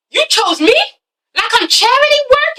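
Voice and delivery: high-pitched mock incredulity; offended disbelief. German answering Asha who says she chose him, mock offended. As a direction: throw the question back, voice climbing high.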